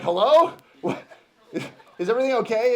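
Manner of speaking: high, loud startled phone answer; alarmed caller demanding to know what happened; mock alarm. Alarmed callback after shocking text.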